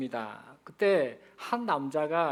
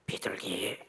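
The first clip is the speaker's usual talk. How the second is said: low, soft secretive whisper; furtive whisper; 9 semitones lower, 6 dB quieter, slower than usual. Acted. Grandmother answering the clerk once more, furtively whispering. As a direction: whisper it after checking who's listening. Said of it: "다시 눈치를 싹".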